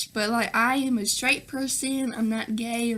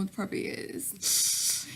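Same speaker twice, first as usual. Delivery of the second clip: hushed and slowed, sharing a secret; slow whispered joke; conspiratorial whisper; 5 semitones lower, 8 dB quieter, slower than usual. Real, not acted. Mock conspiratorial aside.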